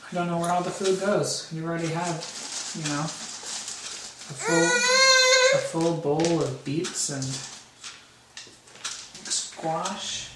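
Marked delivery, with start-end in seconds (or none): 2.7-10.3 amazed. Real, not acted. Dad to baby who ate so much, amazed drawn-out. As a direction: stretch the full in disbelief. Dad marvels at baby's appetite.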